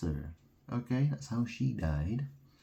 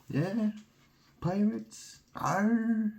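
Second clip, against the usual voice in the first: much higher, hammed-up pirate growl; 14 semitones higher, slower than usual. Joking about Skull and Bones, hammed-up pirate. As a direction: play the pirate for a laugh.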